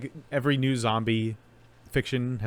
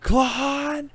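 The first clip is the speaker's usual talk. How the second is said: high, drawn-out dramatic cry; William Shatner; 4 dB louder than usual. Acted. Co-host doing a best actor impression, hammed-up yell. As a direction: bellow it long and hammy, way up high. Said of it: "my best William Shatner impression".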